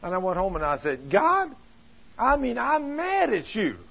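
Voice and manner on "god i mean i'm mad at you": high-pitched, loud complaint to God; fussing angrily. Retold or imitated. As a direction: act out her exasperated tirade at God. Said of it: "I was just fussing at God".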